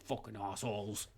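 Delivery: low, soft, disgruntled mutter; muttered disgust. Acted. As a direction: grumble it low to yourself. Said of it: "he'll be like".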